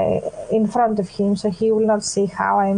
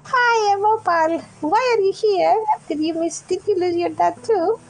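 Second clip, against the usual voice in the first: put-on higher, pleading child voice; lost little girl; 7 semitones higher than usual. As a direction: play a scared kid who lost her dad. Opal child voice continues.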